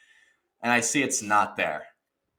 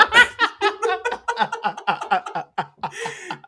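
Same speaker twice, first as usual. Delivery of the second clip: rapid string of high, loud cries; frenzied punching noises; 4 dB louder than usual. Retold or imitated. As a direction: act out a furious pummelling. Acts out punching the bed.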